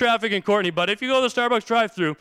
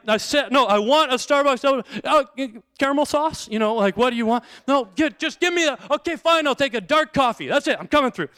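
louder and higher, exasperated shouting into the mic; frustrated ordering; 6 semitones higher, 4 dB louder than usual. Retold or imitated. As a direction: snap your order at a bad speaker. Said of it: "I was so frustrated".

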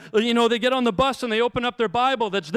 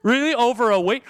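louder and higher incredulous Really; rebuke getting sharper; 4 semitones higher, 5 dB louder than usual. Pretend. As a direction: press the disbelief harder. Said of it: "Imagine if Jesus walked up".

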